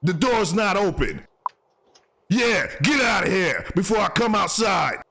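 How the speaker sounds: high-pitched warning holler; mock threat. Host warning off someone trying a door, high mock threat.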